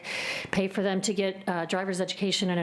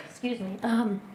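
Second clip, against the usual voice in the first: recovering from a cough; choking on something; 3 semitones higher than usual. Real, not acted. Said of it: "choking on something".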